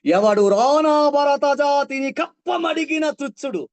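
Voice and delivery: loud, high, slow heroic roar; heroic defiance. Shown as a demonstration. Teacher declaims a warrior's defiant line loudly. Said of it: "వీరత్వం".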